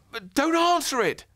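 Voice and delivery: put-on high, loud panicked boy; urgent plea from a scared boy. Ben begging Granny not to open the door, high and loud.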